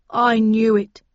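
loud, dismayed outburst; aggrieved wail at full voice; unhappy cry. A narrator's reading. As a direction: cry out your worst fear confirmed. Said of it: "he cried unhappily".

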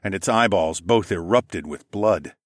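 plain reading voice

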